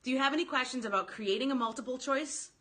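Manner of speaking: ordinary talk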